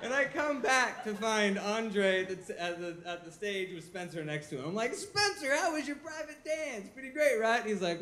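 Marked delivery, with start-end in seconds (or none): 4.9-7.6 much higher, eager question; excited. Retold or imitated. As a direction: gush to your buddy. Excited self, high.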